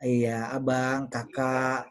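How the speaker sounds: low, drawn-out sweet talk; tender, lingering pet names; affectionate endearments. Shown as a demonstration. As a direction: coo lovingly to a partner, slowly. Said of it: "sayang sama orang dengan cara yang kasar".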